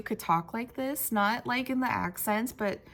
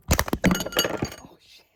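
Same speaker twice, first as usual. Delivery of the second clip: breathy startled oh shit under the breath; a hushed oops at the camera; startled whispered curse; 4 dB louder than usual. Real, not acted. Camera mishap, sudden reaction.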